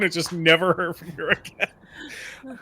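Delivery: ordinary talk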